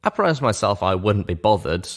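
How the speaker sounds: plain reading voice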